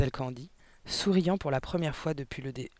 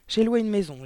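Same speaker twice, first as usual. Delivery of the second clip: higher, louder declarative with falling end; firm announcement; 5 semitones higher, 7 dB louder than usual. A narrator's reading. Niece tells her aunt she is leaving, announcing firmly. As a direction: announce it as settled news.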